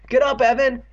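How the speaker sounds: high, loud urging shout; urgent cry. A narrator's reading. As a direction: yell at a friend to move. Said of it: "Andy cried".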